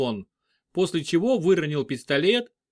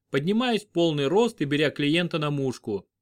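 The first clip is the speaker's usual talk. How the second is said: usual speaking voice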